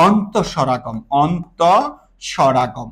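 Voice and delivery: অন্তঃস্বরাগম said slowly, low and soft; slow term drill. Shown as a demonstration. Teacher spelling the new term slowly for students. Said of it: "এটাকে কি বলে জানো?".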